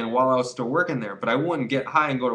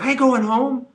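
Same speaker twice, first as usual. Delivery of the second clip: defiant refusal; 11 semitones higher, 6 dB louder than usual. Retold or imitated.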